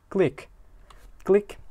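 short high-pitched "click"; camera click imitation. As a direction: say it like a camera shutter, high and quick.

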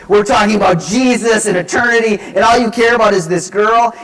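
loud, high indignant rant; exasperated outburst. Retold or imitated. Youth worker inwardly scolding lovesick teen, exasperated outburst. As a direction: explode in hammed-up disbelief.

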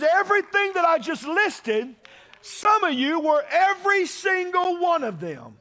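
loud, high, slow preaching shout that eases down; full-force preaching. Acted. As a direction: hammer it home then bring it back down.

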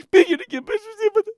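high-pitched crying plea; whining sob of a plea; tearful pleading. Acted. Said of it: "Μην κλαις, τώρα σε έπιασε το κλάμα".

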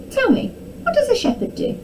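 high, curious storybook princess; eager princess. A narrator's reading. Eager princess questioning the shepherd.

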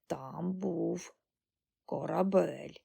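slow, quiet reminiscing; lightly voiced old woman, slowly recalling; frail old granny. A narrator's reading. Ancient granny recalling a ship.

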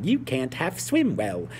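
slow, lightly put-on Cockney accent as Piggy; a hint of Cockney, drawn out slowly. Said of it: "Piggy says something like".

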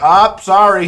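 high, loud mock "sorry"; mock apology. Retelling a call about a forgotten skateboard, loud mock apology. Acting out his phone reply.

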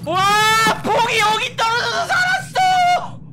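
high, loud, slow disbelieving shout; excited shout. Real, not acted. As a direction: yell in disbelief at the luck. Surviving by luck, excited shouting.

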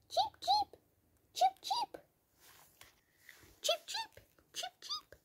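very high, soft chirping; high little cheeps; bird chirp. Pretend. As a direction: peep like a tiny bird.

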